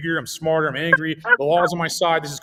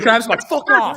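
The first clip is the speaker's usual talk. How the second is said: higher, louder exasperated outburst; mock yell; 6 semitones higher, 6 dB louder than usual. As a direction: blow up at a pestering man. Yelling at annoying homeless man.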